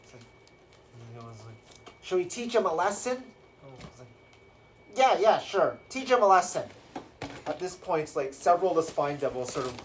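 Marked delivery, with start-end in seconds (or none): 2.0-3.2 lightly voiced henchman, higher, rising question; henchman devil asking. One spine devil asking another, lightly voiced eager question. Second devil asks the first. 5.0-6.6 higher, louder acted go-ahead; devil boss agreeing. Spine devil agreeing to punish prisoner, acted loud order.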